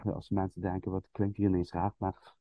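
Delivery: muffled behind a hand; hand over mouth. Shown as a demonstration. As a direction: speak through your hand. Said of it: "ik doe het even na".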